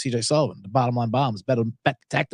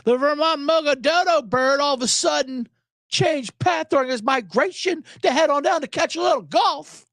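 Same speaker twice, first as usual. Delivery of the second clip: high, lightly voiced fussy bird expert; a touch of indignant birdwatcher, pitched up; Brian Regan impression; 11 semitones higher than usual. Birdwatcher complaining to the network, lightly mock indignant and high. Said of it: "what he says, like".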